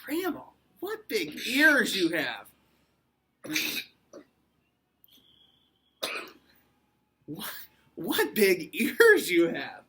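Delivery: loud, slow, high exclamation; drawn-out storybook amazement; astonished little girl. A narrator's reading. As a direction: marvel loudly, stretching the words. Girl marvels at changed Grandma.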